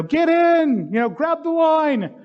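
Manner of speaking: high, loud shout to the man on the roof; urgent shout. Retold or imitated. Helicopter rescuer shouting to the man on the roof, high and loud. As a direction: yell it over the rotor noise.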